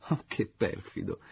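slow, falsetto mock reproach; coy falsetto scolding; in falsetto, lento. A narrator's reading. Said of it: "ella ripeté in falsetto".

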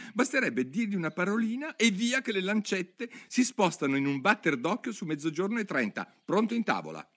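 high, lively eccentric hatter; an excitable hatter explaining; the mad hatter. Acted. Hatter lecturing Alice about time, high and lively.

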